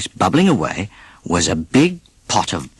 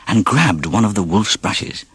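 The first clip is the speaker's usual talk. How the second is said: usual speaking voice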